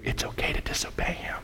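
breathy tempting whisper in the ear; devil's whisper. Pretend. The enemy tempting a believer, hushed whisper.

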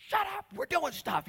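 high, loud irritated; annoyed Martha. Retold or imitated. Martha irritated, telling Mary to shush. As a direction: brush her off.

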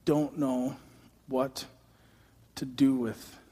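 soft, slowing, trailing off in confusion; a bewildered father losing his voice; dazed quiet Jairus. Retold or imitated. Jairus answers Jesus, bewildered, his voice fading away. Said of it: "Jairus is going".

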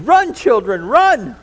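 high, very loud panicked yell; terrified scream. A narrator's reading. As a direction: yell a frantic warning to the children. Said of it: "and she screamed".

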